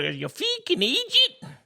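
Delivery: squeaky, drawn-out mock Irish; high-pitched Irish accent. Shown as a demonstration. Correcting co-host's guess, high-pitched mock Irish. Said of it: "I don't know why I went high like that".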